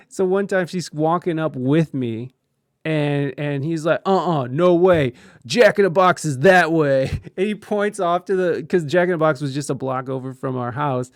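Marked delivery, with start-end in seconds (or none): 4.0-7.2 louder, firm dismissive bro voice; roommate blocking the door. Retold or imitated. Roommate shooing the girlfriend off to Jack in the Box, firm. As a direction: turn her away at the door, pointing elsewhere. Roommate turning her away.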